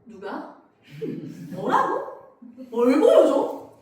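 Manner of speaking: shocked, rising to a loud slow outburst; a parent hearing something alarming; shocked mom turning loud; alarmed parent. Retold or imitated. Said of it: "그럼 여러분들은 심각해져".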